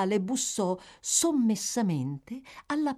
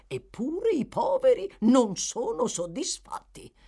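higher, self-important complaint; pompous beadle Bumble; 6 semitones higher, 3 dB louder than usual. A narrator's reading. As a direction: hold forth grandly to the lady. Narrator voices Bumble at length.

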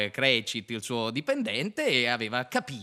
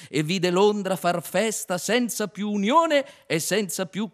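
high, loud climbing tirade; rising frantic narration; 12 semitones higher, 6 dB louder than usual. A narrator's reading. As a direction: build the list to a frenzy. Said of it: "vision narrated at climax".

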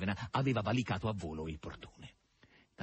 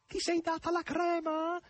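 high, drawn-out calling question; acted shrill-voiced shout across a distance; high-pitched cry. A narrator's reading. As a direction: call the question out slowly, way up high. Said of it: "gridò Margherita con voce acuta".

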